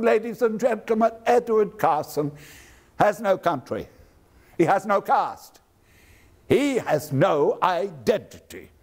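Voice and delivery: ringing Irish brogue of a denouncing orator; Irish brogue, a public denunciation. Retold or imitated. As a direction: denounce him to the hall in a rolling Irish brogue. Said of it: "ringing denunciation in your well-rounded Irish brogue".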